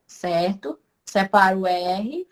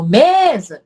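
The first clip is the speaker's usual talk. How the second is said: "mesa" with "me" stretched and much louder; stress on first syllable; 10 dB louder than usual. Shown as a demonstration. Teacher proving the me beats the za, loud stressed first syllable. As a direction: belt out the "me" in mesa, let "sa" drop. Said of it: "Quando você grita pela palavra mesa".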